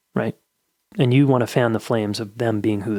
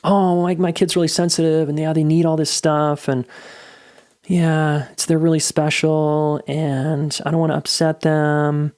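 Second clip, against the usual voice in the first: higher, drawn-out mock-precious parent voice; attachment parenting parent; 4 semitones higher than usual. Parent excusing a really sensitive kid, mocking imitation. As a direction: imitate an over-protective parent, pitched up. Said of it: "people in the attachment parenting camp".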